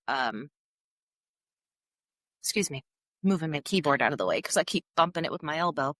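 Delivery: ordinary delivery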